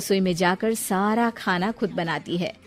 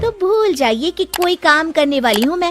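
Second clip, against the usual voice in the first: loud, higher defiant argument; arguing back; 5 semitones higher, 6 dB louder than usual. Acted. Daughter-in-law arguing back with her mother-in-law, loud and defiant. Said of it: "बहस करने लगती है".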